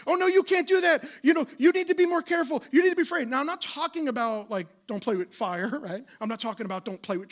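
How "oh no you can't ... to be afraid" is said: high, loud fretting warning; anxious parent. Retold or imitated. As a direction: scold the child like a worried parent. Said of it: "we tell the kids".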